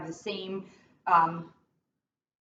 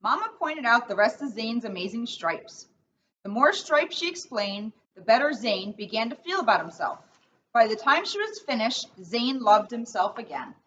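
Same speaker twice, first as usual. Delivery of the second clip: put-on reading to children. Officer reads picture book aloud.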